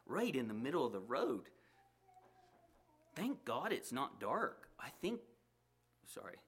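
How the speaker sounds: lively read-aloud storytelling voice with acted characters; story narration. A narrator's reading. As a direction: tell the story to the listeners. Reads a story aloud to congregation.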